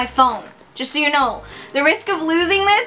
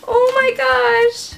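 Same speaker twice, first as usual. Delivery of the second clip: high, slow, choked-up gasps of delight; overwhelmed almost to tears; happy tears welling up; near tears; 10 semitones higher than usual. Real, not acted. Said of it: "I think I'm gonna cry".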